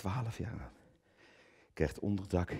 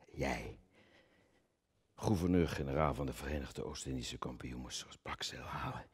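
unvoiced, much softer taunt; mocking whisper; 7 semitones lower, 12 dB quieter than usual. Actor taunting Coen's backdown, whispered. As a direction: hiss the humiliation close to him.

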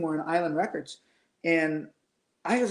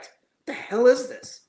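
slow, higher, scoffing question; scornful disbelief; 5 semitones higher, slower than usual. Retold or imitated. Producer hearing weak demos, slow scoffing disbelief. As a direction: drag it out like you can't believe the junk. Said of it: "he said it like that".

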